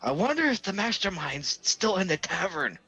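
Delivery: stagey loud wondering. Acted. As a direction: say it high so it will be overheard. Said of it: "I would have said very loudly".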